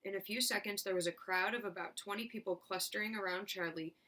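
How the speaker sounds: plain reading voice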